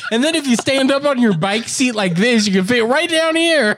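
very high, hammed-up straining voice; strained squeeze-through voice. Teasing friend about moped shortcuts, high hammed-up strain.

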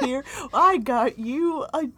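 high, slow cheerful teasing; mock excited. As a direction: present it with hammed-up glee. Mock gift to sick partner.

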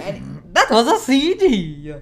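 loud, high mock-Italian exclamation; hammed-up Italian accent, slow and loud. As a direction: draw it out in a big Italian accent. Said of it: "with an Italian accent".

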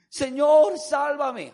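loud, high cry for rescue; acted shout. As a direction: shout for help as a sinking man, acted. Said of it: "comenzó a gritar". (grito de auxilio actuado)

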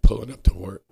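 words break up as the voice gives way; a real voice crack, like a sob. Real, not acted. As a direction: voice cracks high and loud, almost crying. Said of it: "it sounded like you were crying".

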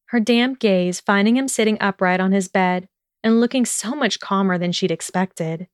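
usual speaking voice

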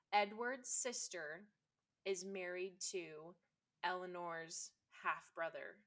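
slowed down, spelling out the family tie; patient step-by-step clarification; slow, deliberate explaining. Shown as a demonstration. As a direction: lay it out word by word.

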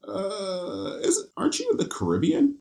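high, baffled mock question; incredulous questioner. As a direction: ask it in a high, puzzled voice. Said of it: "and you're just like".

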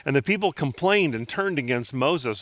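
usual speaking voice

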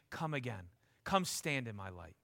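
hushed, low, gentle invitation; tender inviting Jesus. Retold or imitated. Preacher voicing Jesus inviting the broken to him, soft and tender. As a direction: softly draw the listener in close. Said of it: "Eyes warm".

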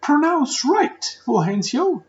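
very high, loud mock scold; shrill imitation of a teacher snapping an order; strict teacher voice. Teacher scolding the boy Fulgencio in a high, loud mock voice. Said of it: "la maestra me decía".

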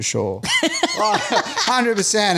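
breaks into a high laugh, then slows. Real, not acted. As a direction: laugh at your own fear of dying. Militia staring, sure they'd die.

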